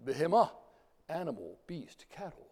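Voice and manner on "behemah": Behemah with the last syllable punched loud; final-syllable accent. Shown as a demonstration. Lecturer showing usual Hebrew accent, Behemah stressed at the end. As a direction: stress Behemah on its closing MAH, raised and louder. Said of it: "accent on the final syllable".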